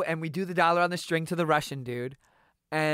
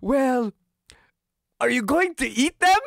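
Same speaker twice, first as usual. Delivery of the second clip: high, louder mock Russian question; Russian accent; 8 semitones higher, 5 dB louder than usual. The Russian dude asking if they'll eat geckos, mock accent. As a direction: ask it in a put-on Russian accent. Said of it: "And he goes".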